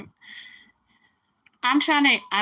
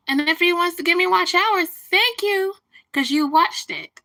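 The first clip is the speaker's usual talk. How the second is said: high, louder mock-grateful thanks; sarcastic thanks; 10 semitones higher, 6 dB louder than usual. Real, not acted. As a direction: thank him with a sneer.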